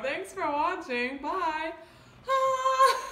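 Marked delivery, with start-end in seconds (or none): 2.3-3.0 high loud pained yelp; burning cry. Real, not acted. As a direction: cry out from the heat. Burning spice after sign-off.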